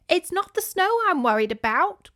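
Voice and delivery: put-on high, anxious old aunt voice; worried great aunt. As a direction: voice the aunt, fretting. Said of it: "Grand Aunt Pip said".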